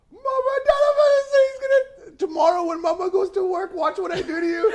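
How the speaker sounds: put-on a scared child tattling through tears; crying, high-pitched child's voice. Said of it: "and then he just broke".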